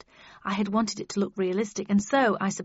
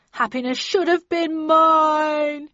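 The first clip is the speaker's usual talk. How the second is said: high, slow sobbing villain; 8 semitones higher, 7 dB louder, slower than usual. Acted. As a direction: wail it out through tears. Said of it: "he sobbed".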